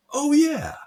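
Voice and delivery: high, bright "Oh, yeah"; sudden recognition. Retold or imitated. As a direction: squeal it like you just remembered. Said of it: "he would have said".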